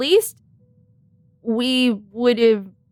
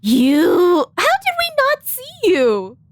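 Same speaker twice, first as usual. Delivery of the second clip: high, slow startled question; surprised; 7 semitones higher, slower than usual. Real, not acted. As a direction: caught off guard, drawn out. Startled by hidden character.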